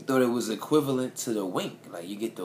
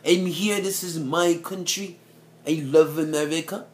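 loud proud declaration; 7 semitones higher, 7 dB louder than usual. Retold or imitated. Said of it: "He said".

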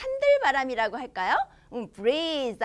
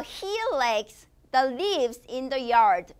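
very slow, word by word; slow model reading; much slower than usual. Shown as a demonstration. Teacher reading the sentence slowly for young learners. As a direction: read it out slowly for kids to repeat.

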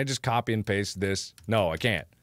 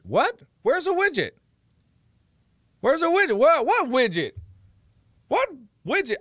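high, louder confused yelling; incredulous outburst; 13 semitones higher, 5 dB louder than usual. Real, not acted. Streamer yells in disbelief at the app's widget, high and loud. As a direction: shout in disbelief at the screen.

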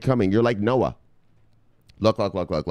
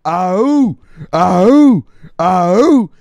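high, loud chanted oh oh oh; war chant; 8 semitones higher, 10 dB louder, much slower than usual. Retold or imitated. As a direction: chant it like a warrior army. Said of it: "You're the Spartans".

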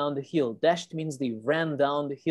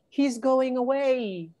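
high, slow story-voice cry; panting; 8 semitones higher, slower than usual. A narrator's reading. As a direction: call it out high and drawn out, barely panting.

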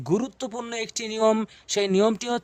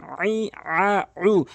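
drawn-out vowels; much slower than usual. Shown as a demonstration. Teacher demonstrating long vowels to viewers, slow and stretched. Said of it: "এক আলিফ টানবেন".

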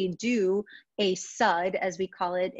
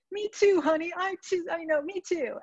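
panicky mom; 10 semitones higher than usual. Retold or imitated. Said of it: "And she said".

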